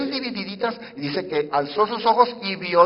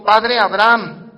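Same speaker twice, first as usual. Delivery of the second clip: loud, slow, higher cry of "Padre Abraham"; 4 semitones higher, 11 dB louder, much slower than usual. Acted. The rich man in torment shouting to Abraham, slow and loud. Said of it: "dando voces".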